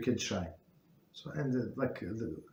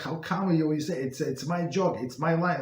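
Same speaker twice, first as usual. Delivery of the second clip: higher, louder mock indignation; imitating angry coworker; 5 semitones higher, 4 dB louder than usual. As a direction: act out the fuming colleague's protest. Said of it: "and he was so angry".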